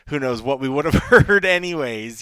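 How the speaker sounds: amused, laughter breaking into the sentence; loud laughing aside; laughing through words. Real, not acted. Laughing at fighter's attempted English.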